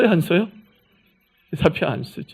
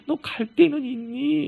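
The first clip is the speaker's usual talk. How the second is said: caring question; 7 semitones higher, slower than usual. Shown as a demonstration. Preacher showing how God asks runaway Hagar, tenderly. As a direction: ask it the way a worried parent would. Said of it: "이렇게 읽어야 되는 거예요".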